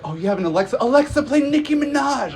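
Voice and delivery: high squeal rising to a shout; excited drunk friend. Retold or imitated. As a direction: shriek the command like a giddy drunk. Drunk friend excitedly commanding Alexa.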